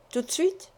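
"Tout de suite" as a question, rising sharply on "suite". Acted. Husband checks when she put it away, questioning rise on "suite". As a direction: lift the end of "suite" to ask.

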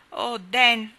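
loud, dismayed cry. A narrator's reading. Nat crying out to Dan, loud dismay. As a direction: call his name in distress. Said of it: "gridò Nat".

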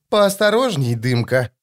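plain reading voice

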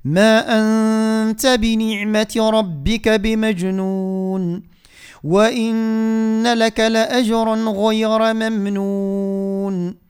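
slow, drawn-out tajwid recitation; Quran recitation. Shown as a demonstration. Teacher recites for the listeners, slow tajwid chant. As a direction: recite each word long and measured.

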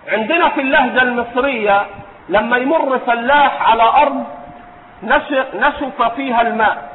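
usual speaking voice

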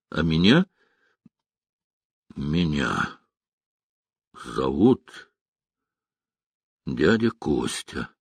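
low, halting, long pauses between words; forced out, hesitant. A narrator's reading. Man introducing himself to the little girl, haltingly forcing it out. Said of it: "выдавил Рокоссовский, не зная, как представиться малышке".

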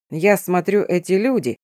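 plain reading voice

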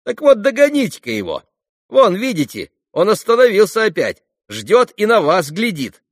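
high, loud schoolboy voice; goading boy. Acted. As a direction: urge him on like an excited kid. Boy urges Alyosha on. (задиристый мальчишеский голос)

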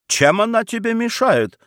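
high, irritated retort; flaring up. A narrator's reading. Mother defending the portrait to her son, flaring up. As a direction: fire the question back, pitched high. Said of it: "в свою очередь вспылила баронесса".

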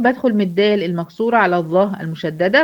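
ordinary talk

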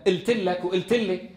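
"التلك" with a glottal stop for qaf; qaf as hamza. Shown as a demonstration. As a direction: start "إلتلك" on a glottal stop, no q. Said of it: "بقلب القاف همزة". (نطق "إلتلك" بالهمزة بدل القاف)

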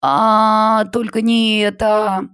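very high, drawn-out wail; girl wailing at teacher's pep talk, anguished. A narrator's reading. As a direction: howl in despair. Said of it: "завопила Полина".

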